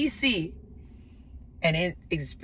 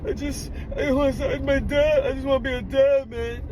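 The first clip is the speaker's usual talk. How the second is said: high, loud sobbing imitation; imitated tearful pleading, pitched way up; mock crying; 8 semitones higher, 5 dB louder than usual. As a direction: wail it like a man in tears, hammed-up. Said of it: "Man crying".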